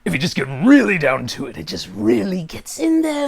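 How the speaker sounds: intense villain voice, digging into the words; Kevin Michael Richardson's Joker. Acted. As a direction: really get down into the villain voice. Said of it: "it's a little bit more deeper".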